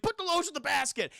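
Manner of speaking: high, hurried villain command; imitated exasperated demand; mock barked order. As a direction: snap it out as an impatient movie captor. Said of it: "and he's just like".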